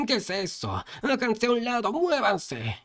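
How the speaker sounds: high, urgent cries; high-pitched alarm of a fleeing crowd; panicked crowd shouts. A narrator's reading. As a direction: call out high to clear the way.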